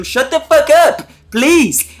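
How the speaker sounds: high, slow mock yell; mock snap. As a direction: bark it at him, half-joking. Drake snapping at Josh.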